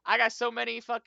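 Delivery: loud, high-pitched flustered excuse. Acted. Researcher bowing out to the officer, loud and flustered. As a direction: blurt the excuse loudly, up high. Rochelle protesting how busy he is.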